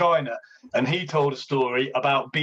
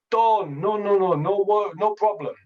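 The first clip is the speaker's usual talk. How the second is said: Jamaican twang; 4 semitones higher than usual. Retold or imitated. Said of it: "I think he's got a Jamaican twang".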